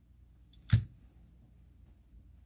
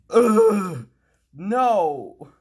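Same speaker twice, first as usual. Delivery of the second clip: frustrated groan; 10 semitones higher, 13 dB louder than usual. Retold or imitated. Mimics his frustration.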